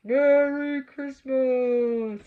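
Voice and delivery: loud, slow, drawn-out holiday shout; the conductor shouting from the train to the boy; shouted greeting. A narrator's reading. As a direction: call it out from a moving train. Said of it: "he shouted".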